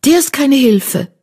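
high, scornful dismissal; spitting anger. Acted. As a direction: dismiss him with a spat remark. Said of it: "fauchte Laura".

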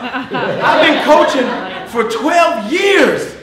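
high, slow, booming shout; loud drawn-out holler; getting loud. Real, not acted. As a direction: shout it proudly, stretching the words. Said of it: "I was gonna get loud".

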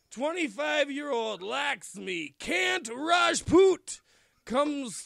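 slow-named wrestler bio read like an announcer; mock-serious prospect announcement. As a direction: introduce the trainee like a promo read. Reading wrestler bio aloud.

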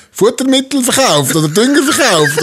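laughing while quoting. Retold or imitated. Farmer's father voiced, laughing.

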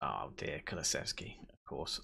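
low, very soft resignation; quiet dismay. Real, not acted. Gamer watching Kulusevski against the team, quietly resigned. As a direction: drop to a deflated near-murmur. Rival player threatens, gamer deflates.

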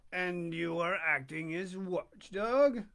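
steady storybook reading voice; story narration. A narrator's reading. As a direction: read it to the listeners like a bedtime chapter. Reads Pinocchio aloud.

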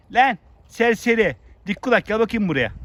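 high, loud teasing call. Real, not acted. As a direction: call the donkey over from afar. Calling a donkey over.

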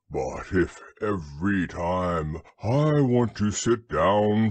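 low, slow, ponderous donkey; deep and drawn-out complaint; grumbling Eeyore. A narrator's reading. Donkey objects to Rabbit's relations, low slow grumble.

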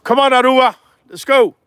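high, loud call; calling the dog. Real, not acted. Breeder calling Aruba to come, loud and high. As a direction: call her in from a distance.

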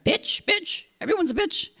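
high, softened mock ranting; imitated name-calling. Man insulting the neighbors, high-pitched mocking imitation. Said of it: "he started call-, bitching her".

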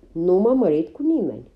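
loud, sharp hiss of protest; hissed retort. Acted. Lydia hits back at the accusing women, loud and sharp. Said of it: "Lydia șuieră".

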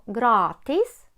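"gratis" with a drawn-out long a. Shown as a demonstration. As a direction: stretch the a in "gratis". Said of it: "з довгою «а»".

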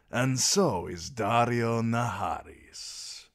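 slow, showy and grand; flamboyant sellsword. A narrator's reading. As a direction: lay on the charm, drawn out. Daario voice.

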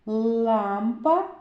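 "Лампа" with the first "а" drawn out; vowel stretched. Shown as a demonstration. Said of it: "Зробимо звуковий аналіз слова".

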